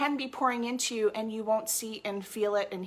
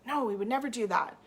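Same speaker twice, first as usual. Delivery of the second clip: ordinary talk, nothing marked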